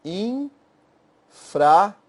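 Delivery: very slow, drawn-out infra; teacher slowly building the acronym; slowly spelled out. Shown as a demonstration. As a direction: stretch infra out for the class. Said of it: "Pego infra".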